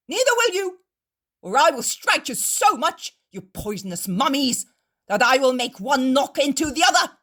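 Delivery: loud, high threatening shout; angry threat. A narrator's reading. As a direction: threaten to strike them, slowing on orders. Innkeeper threatens the Pharisees.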